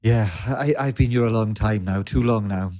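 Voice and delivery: normal talking voice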